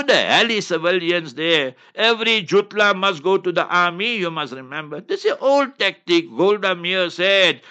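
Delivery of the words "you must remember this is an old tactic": ordinary delivery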